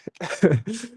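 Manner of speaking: laughing. Real, not acted. Laughing at house prices.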